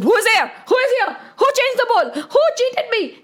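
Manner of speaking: high, loud frantic questions; sounding crazy. Acted. Rana looks around for the cheat, crazed and scared. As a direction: fire off accusations like a madman. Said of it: "He was sounding like a crazy man".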